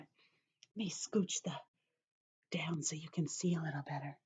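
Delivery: unvoiced aside whisper. Real, not acted. Teacher whispering an aside while adjusting the paper. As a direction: mutter it to yourself quietly.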